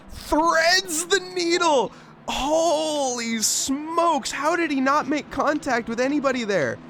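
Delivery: high, slow amazed exclaim. Real, not acted. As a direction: marvel at the miss, way up high. Replay of near miss.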